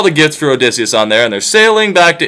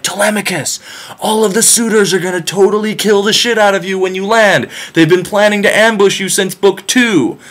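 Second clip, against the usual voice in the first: higher, airy divine messenger; breezy warning from above; goddess voice; 7 semitones higher than usual. Retold or imitated. Athena warning Telemachus, higher breezy goddess voice.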